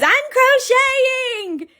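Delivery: very high, slow, sing-song squeal; giddy slogan. Retold or imitated. Joking about a t-shirt slogan, giddy squeal. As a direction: stretch it out like a joyful catchphrase. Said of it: "a t-shirt that just says".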